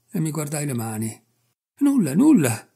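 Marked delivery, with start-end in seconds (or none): 1.8-2.7 a higher, louder frightened moan. A narrator's reading. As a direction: whimper it out, pleading. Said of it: "gemette quello".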